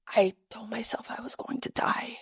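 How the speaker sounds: weak, whispered confession; losing voice. Retold or imitated. Runner explaining her panic to her friend, voiceless whisper. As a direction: imitate a voice nearly gone, whispering. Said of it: "I also was losing my voice".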